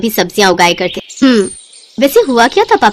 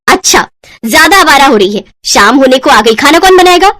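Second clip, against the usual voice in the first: angry scolding; 4 semitones higher, 4 dB louder than usual. Acted. Babita scolds Ritika.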